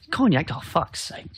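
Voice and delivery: exasperated. Acted. Character fumbling the word cognac.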